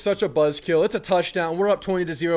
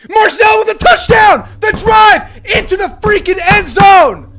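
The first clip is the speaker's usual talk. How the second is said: roaring, much higher and louder; touchdown shout; 12 semitones higher, 12 dB louder than usual. Real, not acted. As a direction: scream it as your team scores. Big play, fan celebrating touchdown.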